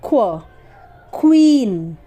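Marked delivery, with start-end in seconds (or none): 0.1-0.4 "क्व" as half k run into wa; k and w joined in "क्व"; blended kwa sound. Shown as a demonstration. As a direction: say "क्व" as one syllable, kwa. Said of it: "आधा क और व".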